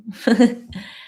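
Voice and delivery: little laugh after a thank-you; quick burst of amusement; short laugh. Real, not acted. Chuckles after thanking a viewer.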